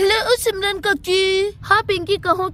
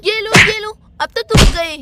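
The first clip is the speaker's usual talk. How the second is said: loud scolding yells; playful angry shout; 3 semitones higher, 7 dB louder than usual. Acted. Simran beating Raj with a basket, shouting. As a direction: punish him with each blow.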